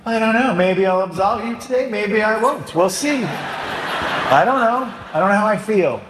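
wishy-washy priest. Pretend. Priest mocking a vague confessor with an indecisive impression. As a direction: play a flaky priest who can't decide, with a laugh. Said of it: "What if I said".

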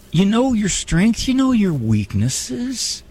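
deliberate slow reassurance, "know" stretched; slow emphasis. Shown as a demonstration. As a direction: spell it out slowly so it sinks in. Slow emphatic restatement of point.

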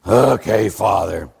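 low, grudging mock assent; reluctant agreement. Man promising the priest, imitated reluctant assent. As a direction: give in heavily, voice dropping low.

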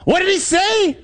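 high-pitched holler over the storm; comic straining yell; yelled question. Acted. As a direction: shout it as if you couldn't hear a thing. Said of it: "Rick turns to me and he says".

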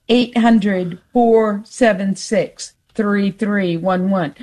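slow, measured digits; slow phone number. Real, not acted. Host repeating the pledge line for callers, slowly. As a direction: space out each group of digits. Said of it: "saying it so slow".

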